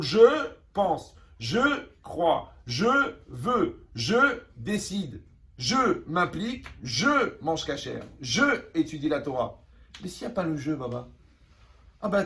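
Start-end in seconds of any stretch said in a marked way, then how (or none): 0.0-9.6 each step laid out slowly and weightily; slow emphatic list. Acted. Rabbi listing a believer's acts, slow and emphatic. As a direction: spell out every step, slowly.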